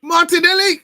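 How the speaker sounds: high loud shout of the name; excited call. Real, not acted. Following the Arsenal attack live, loud excited call.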